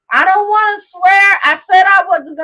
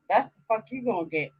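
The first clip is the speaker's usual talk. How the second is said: ordinary talk, nothing marked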